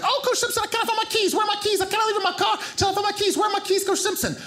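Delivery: very high-pitched, rushed comic impression; hammed-up panicked woman's squeal; frantic Zambian woman. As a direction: burst in panicking in a high mimicked voice. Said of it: "she burst in there just like".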